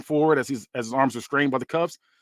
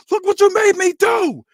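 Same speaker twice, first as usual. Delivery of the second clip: much higher, louder and slow; acted outburst; 6 dB louder, slower than usual. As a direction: blame him as you hit him. Tony voiced beating his son.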